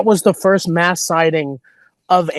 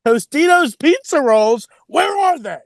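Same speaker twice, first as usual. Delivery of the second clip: high, whiny demand for snacks; pampered star whining at an assistant; diva demand; 7 semitones higher than usual. Pretend. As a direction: play a spoiled star barking for pizza rolls. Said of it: "I'm gonna go".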